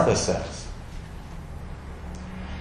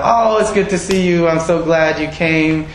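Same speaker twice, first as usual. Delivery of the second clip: high, eager, delighted greeting; hammed-up overjoyed hostess; gushing welcome; 6 semitones higher than usual. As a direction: sound thrilled to see him. The welcome she should have given.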